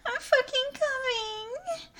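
high climax wail. Acted. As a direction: wail it out at the peak. Acted climax peak.

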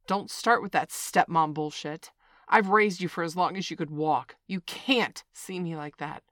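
loud, angry lecture; furious scolding. Acted. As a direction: shout her down. Scolding argument, furious parent.

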